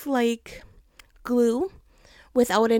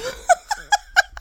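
high laughing cackle; 6 dB louder than usual. Real, not acted. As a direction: laugh high, almost crying. Laughing at own story.

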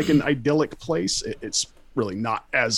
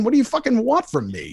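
high-pitched, exasperated plea; retold terror; 10 semitones higher than usual. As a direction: plead with an attacker, voice jumping up, half acted. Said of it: "I was terrified".

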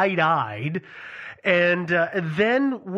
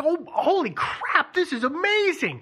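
high-pitched, laughing awe; imitated amazed crowd; 10 semitones higher than usual. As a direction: play a crowd losing its mind, pitched way up. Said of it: "the crowd would lose their mind".

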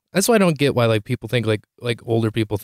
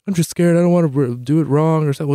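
high, fretful mock protest; mock-scared worrying, pitched up; imitating scared mom; 5 semitones higher, 3 dB louder than usual. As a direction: whine like a mom afraid to try. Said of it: "it's the part of it's the terrifiedness of it".

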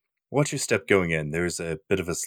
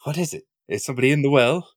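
high, excited dog-owner voice; like calling out to Lassie; talking to a dog; 10 semitones higher, 8 dB louder than usual. Pretend.

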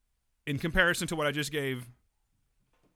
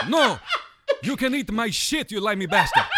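loud, high hammed-up tirade; cranky movie-character ice cream man; 8 semitones higher, 10 dB louder than usual. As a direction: refuse and insult the customer in character. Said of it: "Be like him as an ice cream man".

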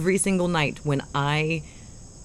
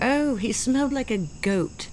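raised-pitch British lady voice; mock British accent; 6 semitones higher than usual. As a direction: savour the line in prim British. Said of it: "try an accent to say ancient sheep".

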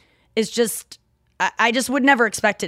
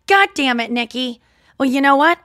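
loud, high Midwest mom scolding; exasperated mom impression; imitating mom; 5 semitones higher, 6 dB louder than usual. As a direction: scold loudly in mom's Midwest voice. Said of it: "sound like my mom".

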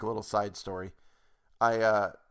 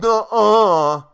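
high, loud bratty denial; mock a know-it-all kid; kid's retort; 10 semitones higher, 13 dB louder than usual. Restaurant kid contradicting the narrator, high bratty denial. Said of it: "he goes".

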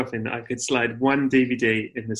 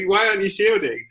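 higher-pitched mock annoyance; annoyed; 6 semitones higher than usual. Friends scold a man out during lockdown, higher-pitched mock annoyance. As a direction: act out irritated friends demanding an answer. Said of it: "they were annoyed".

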